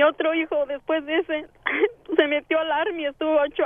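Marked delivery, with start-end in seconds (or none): 1.6-2.1 a short rising sob; real sob. Real, not acted. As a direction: catch of a sob mid-sentence. Mother of missing son.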